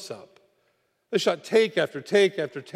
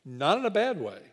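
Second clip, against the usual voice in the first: ordinary delivery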